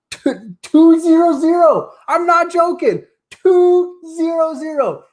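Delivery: high, loud and slow, spelling out each number; triumphant. Real, not acted. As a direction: savor the big moment. Announcing 2000 subscribers live.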